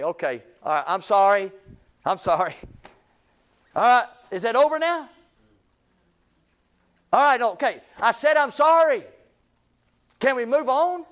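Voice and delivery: grudging apology. Acted. Preacher acting out a worldly apology to someone correcting him, exasperated. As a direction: toss out a loud, impatient, worldly sorry. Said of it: "A worldly sorrow is say".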